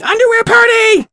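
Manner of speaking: very high, loud party shriek; shouting. Acted. Pink girl throwing confetti at the crowd, high loud shout. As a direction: scream it with wild glee.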